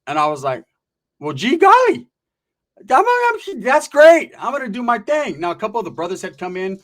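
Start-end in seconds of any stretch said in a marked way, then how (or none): 1.2-5.4 high, loud goody-goody voice; mock innocent reply. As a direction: play the wide-eyed innocent, way up high. Mock polite reply to gang youngster.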